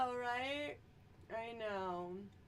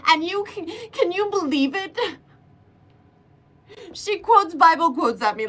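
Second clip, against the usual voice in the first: normal talking voice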